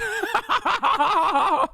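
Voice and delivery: high laugh. Real, not acted. Laughing at clip's claim.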